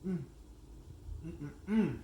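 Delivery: high pleased hums; savoring mm. Real, not acted. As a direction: hum with delight over food. Savoring the cookie.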